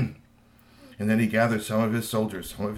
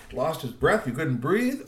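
usual speaking voice

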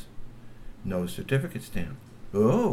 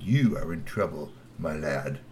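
stern; 7 semitones lower than usual. A narrator's reading. Glove stern.

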